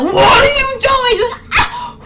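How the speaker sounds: slow, high, very loud wail on waking; hammed-up startled shriek; panicked yell. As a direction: yell as if jolted awake. Acted waking in panic, mom screams.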